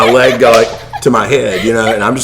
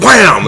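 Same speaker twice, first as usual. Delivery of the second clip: mock wham; 8 semitones higher, 7 dB louder than usual. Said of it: "I was like".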